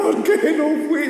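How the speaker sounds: high, drawn-out mock wail; hammed-up tearful complaint; mock crying. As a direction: whine it like a pretend crybaby. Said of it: "no crea que yo estaba llorando".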